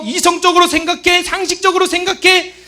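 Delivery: loud, high shushing; forceful acted telling-off; scolding voices. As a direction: fire off rebukes loudly. Acts out rebuking voices.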